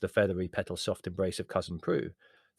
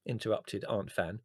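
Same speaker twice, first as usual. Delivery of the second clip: ordinary delivery, nothing marked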